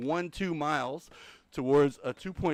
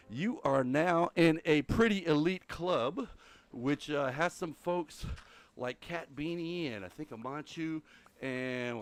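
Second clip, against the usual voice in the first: normal talking voice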